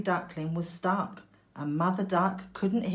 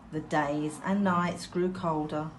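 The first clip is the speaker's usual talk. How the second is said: ordinary delivery, nothing marked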